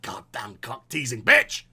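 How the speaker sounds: low, slow, muttered insult; mock bitter curse. The jilted suitor cursing the flirt in a low, slow grumble. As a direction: grumble it through your teeth. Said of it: "you're just like".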